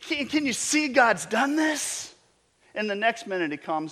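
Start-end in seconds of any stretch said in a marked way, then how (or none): 0.0-2.2 higher, slow, pleading question; Job pleading. Retold or imitated. Job turning to his friends, a slow high pleading question. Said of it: "he's like".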